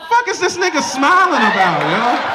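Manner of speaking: hammed-up jealous snap; mock suspicious girlfriend. To the ladies, hammed-up jealous girlfriend. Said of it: "Or do you go".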